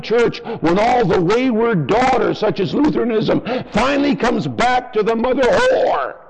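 preaching at full force. Acted. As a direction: thunder it from the pulpit. Fiery sermon on religion.

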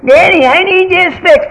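put-on high whiny child asking daddy; squeaky little kid pleading; child's voice. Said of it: "And the kid says".

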